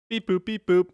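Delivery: high, loud beep boop noises; robot beeps. Pretend. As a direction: chirp it like a little robot. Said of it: "Hence the beep and the boop".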